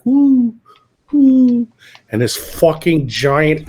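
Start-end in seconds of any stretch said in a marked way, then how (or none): 0.1-1.7 high, loud imitated dove coo. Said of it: "he was like".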